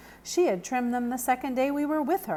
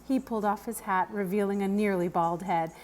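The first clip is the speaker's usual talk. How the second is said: ordinary delivery, nothing marked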